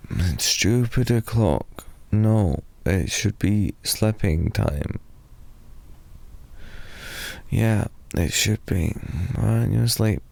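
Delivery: ordinary talk, nothing marked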